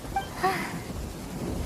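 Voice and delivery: a quiet breathy sigh of relief; soft sigh. Acted. Assistant sighs softly before reporting the patient's progress. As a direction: let out a long hushed sigh.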